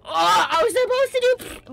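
ordinary talk, nothing marked

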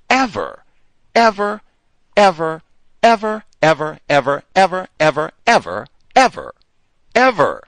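EVER shouted over and over, high and loud; very emphatic. Real, not acted. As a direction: hit every EVER as a separate loud, high burst. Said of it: "saying it with emphasis on my chest".

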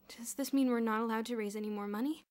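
mildly alarmed questioning; anxious. A narrator's reading. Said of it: "asked Boots anxiously".

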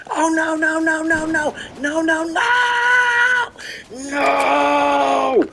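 rising, drawn-out high wail of no; panicked wailing. Real, not acted. Player in trouble on stream, panicked wail. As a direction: wail it out, climbing into a long cry. Panics as the game goes wrong.

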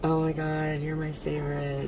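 low, soft and deadpan; underwhelmed, barely reacting; flat muted reaction. Shown as a demonstration. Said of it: "more like this".

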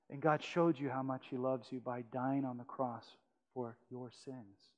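normal talking voice

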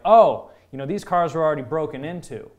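loud exclaimed "oh" of discovery; mock realization. Imitated thieves coming upon the cars, loud mock surprise.

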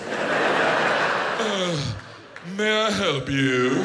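snooty British shopkeeper. Retold or imitated. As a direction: look down your nose at a scruffy customer. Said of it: "British people always talk to Americans like".

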